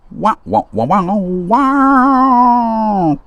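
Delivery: long, high mock crying wah; a sarcastic wah wah sob. Mocking a whiny liar.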